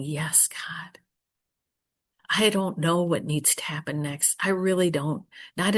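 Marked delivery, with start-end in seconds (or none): 0.0-1.0 soft breathy whisper to God, almost no voice; whispered prayer. Retold or imitated. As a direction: breathe the words out as a private prayer.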